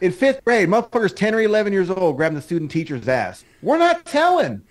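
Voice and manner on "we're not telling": mock kid voice. Kids keeping the secret.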